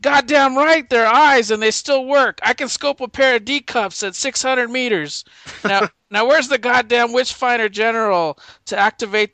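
loud, high, boastful military rant; hammed-up general barking at his soldier; profane general. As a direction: bellow like a vulgar commander. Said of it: "what comes out of this guy's".

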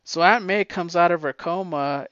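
ordinary delivery

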